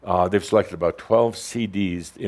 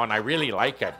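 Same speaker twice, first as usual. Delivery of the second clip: ordinary talk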